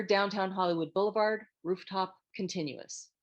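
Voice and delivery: ordinary talk, nothing marked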